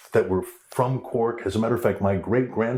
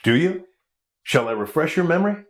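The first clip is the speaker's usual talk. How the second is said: mock menace; 5 semitones higher than usual. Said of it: "just to scare him".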